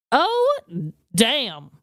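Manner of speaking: usual speaking voice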